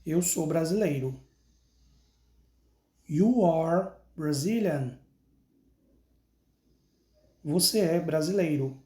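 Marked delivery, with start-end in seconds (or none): 3.1-5.0 slow, clear "I am Brazilian" for pupils to repeat; slow model sentence. Shown as a demonstration. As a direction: say each word of "I am Brazilian" slowly so they can copy. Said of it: "Então, repita comigo". (devagar e claro, para o aluno repetir)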